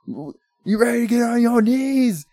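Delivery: high, slow mock holler; hyped-up con man. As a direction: work the mark up, pitched high and stretched. Retold con man hyping the guy.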